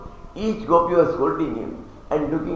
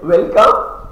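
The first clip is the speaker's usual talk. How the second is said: sarcastic welcome, louder with a rise; mock welcome; 4 semitones higher, 8 dB louder than usual. Gopis mocking Krishna's welcome, loud and sarcastic. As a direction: throw their own greeting back at him. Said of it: "You said".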